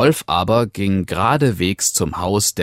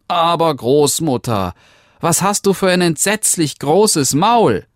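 put-on little girl; 10 semitones higher, 5 dB louder than usual. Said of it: "rief es".

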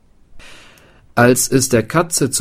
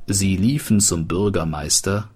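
ordinary delivery, nothing marked

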